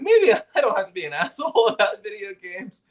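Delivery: half-laughing inner thought; giggling while recalling his realization. Retold or imitated.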